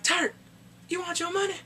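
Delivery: high, put-on taunting voice; taunting call. Killer asking Turk about money, high mock taunt. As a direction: call out to him mockingly. Acting character calling to Turk.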